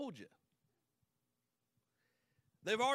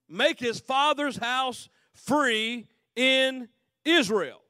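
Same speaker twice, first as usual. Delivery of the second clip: loud, slow and climbing higher; rising proclamation; 3 semitones higher, 8 dB louder, slower than usual. Acted. Men of Israel announcing the king's reward, building loud. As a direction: build the promise louder and higher.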